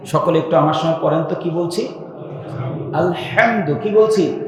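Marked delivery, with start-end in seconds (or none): 2.9-3.7 আলহামদু with the হা pressed hard in the throat; hard throaty ha. Shown as a demonstration. Preacher repeating the word for followers, hard throaty ha. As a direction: make the হা of আলহামদু tight and throaty. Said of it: "কঠোর করে আমি পড়েছি".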